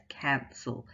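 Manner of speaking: "cancel" with a big, open first syllable; "cancel" slowly demonstrated, stress on CAN. Shown as a demonstration. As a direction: lean on CAN in "cancel", let the second syllable shrink. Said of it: "second syllable is much smaller".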